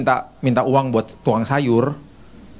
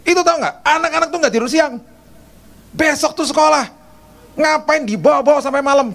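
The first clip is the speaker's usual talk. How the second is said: wife's scolding tone; 12 semitones higher than usual. Retold or imitated. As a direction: scold him for keeping the kids out late. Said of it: "nadanya gini nih".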